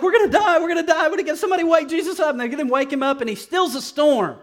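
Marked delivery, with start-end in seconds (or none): 0.0-2.3 high, loud frantic cry that the boat is sinking; panicked disciples. Retold or imitated. Disciples panicking in the storm, high frantic shouting. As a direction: rattle it off in a panic, fast and loud. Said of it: "and they're like".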